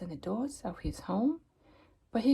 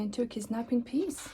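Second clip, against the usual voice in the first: ordinary delivery, nothing marked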